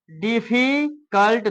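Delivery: "डिफिकल्ट" in two parts, diffi, cult; split into syllables. Shown as a demonstration. Teacher showing two syllables, "डिफिकल्ट" split as diffi-cult. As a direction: break "डिफिकल्ट" with a gap before cult. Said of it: "दो syllable हो गया".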